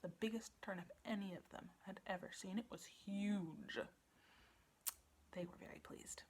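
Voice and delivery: soft whispered wonder; hushed whisper. A narrator's reading. Storyteller marvelling at the huge turnip, whispered. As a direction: draw them in with a whisper.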